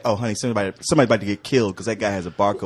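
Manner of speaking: low, fast mock gossip; lightly mimicked diner gossiping. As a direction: dish it out like a bistro gossip. Gossiping bistro patron voice.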